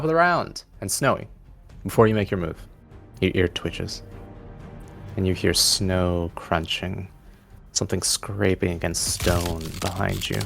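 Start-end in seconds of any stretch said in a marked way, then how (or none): none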